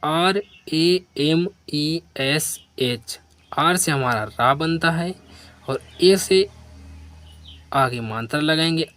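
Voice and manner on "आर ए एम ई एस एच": letter by letter, spaced out; dictating letters to a class; slow spelling. Shown as a demonstration. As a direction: spell each letter slowly. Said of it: "आगे लिखा है".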